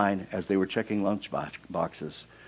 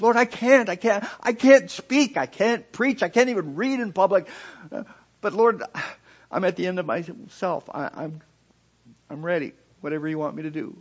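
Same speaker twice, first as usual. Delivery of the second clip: high, pleading, slowing surrender; beg, then let go; anguished prayer; 11 semitones higher, 6 dB louder than usual. Retold or imitated.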